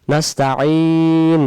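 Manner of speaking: নাস্তাইন with a long held vowel; drawn out. Shown as a demonstration. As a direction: stretch নাস্তাইন slowly. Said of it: "টান দিয়ে বলবো". (টান দিয়ে ধীরে)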